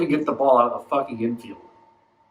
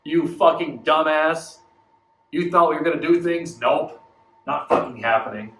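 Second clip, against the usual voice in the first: mocking taunt; 3 dB louder than usual. Team taunting the fan, loud mocking sneer. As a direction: gloat like a cruel ex. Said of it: "they're like".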